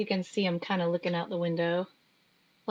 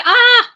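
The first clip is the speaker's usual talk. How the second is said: startled squeal; 15 dB louder than usual. Pretend. Startled by the dog.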